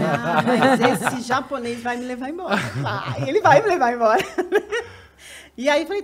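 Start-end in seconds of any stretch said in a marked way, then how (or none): 0.1-4.9 laughing through the words. Real, not acted. Amused at herself about the man driving her home, laughing. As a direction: laugh at yourself while you say it.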